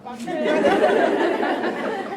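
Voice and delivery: big burst of loud, high laughter; loud, high laughing fit. Real, not acted. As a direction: crack up loudly. Laughing at dad's idea.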